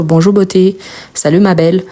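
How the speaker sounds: normal talking voice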